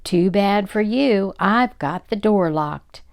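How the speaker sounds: loud, slow taunt; rude calling out. Acted. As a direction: gloat rudely at the loser. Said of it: "laughed rudely and called out".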